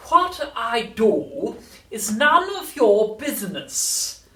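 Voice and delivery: put-on high, loud, drawn-out old woman voice; slow, piercing storybook hag; cantankerous old woman. A narrator's reading. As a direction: stretch it out haughtily as a cross grandma. Voicing the grumpy old grandmother.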